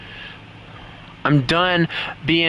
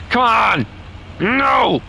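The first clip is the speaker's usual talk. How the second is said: screaming no; 12 semitones higher, much slower than usual. Real, not acted. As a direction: scream in despair, building. Losing the plane in game.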